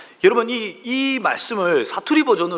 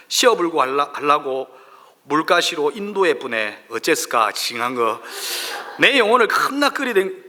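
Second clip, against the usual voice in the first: Jeolla dialect reading. Acted. Pastor reads Psalm 23 to a laughing congregation in Jeolla dialect. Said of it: "전라도 버전이에요".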